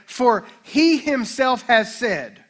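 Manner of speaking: HIMSELF and SAID stressed, drawn out slowly; emphatic. Shown as a demonstration. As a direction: read "he himself has said" very slowly, weight on each word. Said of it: "is especially emphatic".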